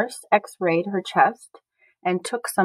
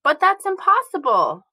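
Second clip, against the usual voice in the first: shocked cry; 8 semitones higher than usual. A narrator's reading. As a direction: cry out in horrified disbelief. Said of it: "Once her mother cried".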